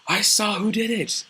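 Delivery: high, loud, excited announcement; acted tattling shout. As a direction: call it out like an eager tattletale. Tattler runs up calling out.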